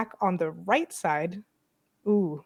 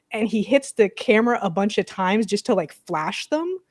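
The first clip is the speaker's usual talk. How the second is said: usual speaking voice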